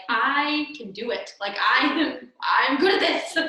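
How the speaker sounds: loud, punchy self-assurance with a laugh creeping in; cocky boast. Retold or imitated. Teacher recalling her confidence with a crying toddler, mock cocky. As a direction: boast about it, hammed-up and loud.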